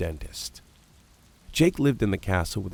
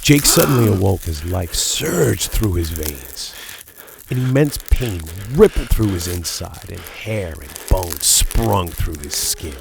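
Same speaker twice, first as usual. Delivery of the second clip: intense narration; 7 dB louder than usual. A narrator's reading. Werewolf transformation surge.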